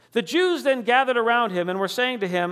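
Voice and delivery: usual speaking voice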